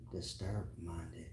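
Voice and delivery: slow whispered aside. Real, not acted. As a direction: whisper it under your breath.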